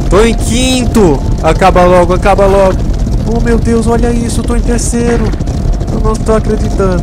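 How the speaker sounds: high thrilled disbelief; can't believe it, thrilled; excited. Real, not acted. Rising in race ranking.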